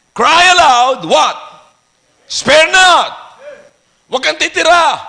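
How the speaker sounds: loud, punched scripture calls; shouted preaching. Real, not acted. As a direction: drive each phrase at the congregation.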